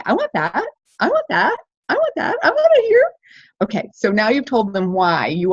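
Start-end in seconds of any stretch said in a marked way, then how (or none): 0.0-3.0 each repeat climbing higher and more excited; building eagerness. Shown as a demonstration. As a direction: build from interest to craving. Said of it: "we're getting people to say".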